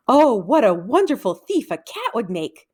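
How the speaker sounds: ordinary delivery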